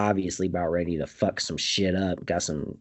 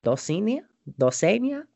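rising, pleading calls of the name, higher each time; calling the name; 6 semitones higher than usual. Retold or imitated. Retelling a character calling for Dulcinea, rising. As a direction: call out her name, questioning.

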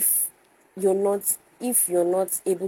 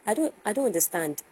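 ordinary talk, nothing marked

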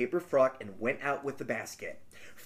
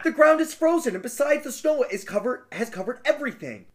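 put-on higher, pleading young girl; 7 semitones higher, 3 dB louder than usual. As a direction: beg in a high girlish voice. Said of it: "said the girl".

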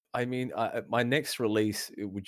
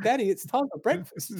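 put-on much higher, laughing little-girl voice; child voice; 5 dB louder than usual. Daughter coming in at dawn to wake Daddy for breakfast, high laughing child voice. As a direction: chirp it like an eager little daughter, giggling through it. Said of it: "You're like".

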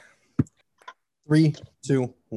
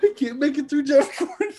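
after laughing hard; 9 semitones higher than usual. Real, not acted.

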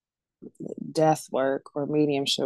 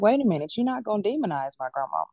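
higher-pitched, indignant retelling; retold protest; 6 semitones higher, faster than usual. Granddaughter answers church members about grandma, protesting. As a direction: push back in defence of someone loved.

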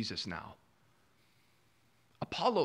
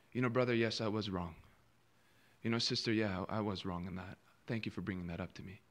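soft, much quieter humble confession; humble admission; 8 semitones lower, 7 dB quieter than usual. Retold or imitated. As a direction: gently own up to the mistake.

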